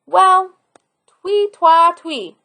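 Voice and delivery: sing-song whistle; whistled tune. Acted. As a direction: whistle the notes like a happy boy. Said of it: "whistled the boy".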